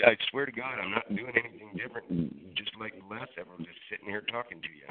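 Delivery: faint voice drifting away from the phone; muffled and distant, like inside an oil drum; fading in and out. Real, not acted. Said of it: "like you're inside of a oil drum talking".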